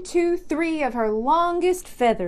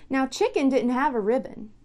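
ordinary delivery

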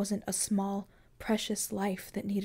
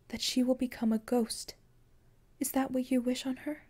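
higher, grave voice for Death; Death, raised higher; 5 semitones higher than usual. Acted. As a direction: let Death's warning lift higher. Death warns of ghost.

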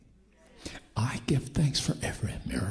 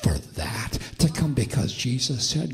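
full-force preaching; 4 dB louder than usual. Real, not acted. Preacher declaring healing to the church, bold and forceful. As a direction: proclaim it boldly, stretching key words.

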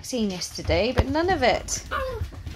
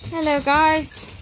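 ordinary delivery

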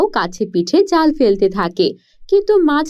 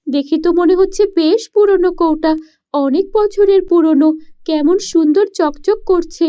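put-on higher, eager girl's voice; a poor village girl puzzling aloud; young girl voice; 4 semitones higher than usual. Daughter asks her parents why, in a higher girl's voice.

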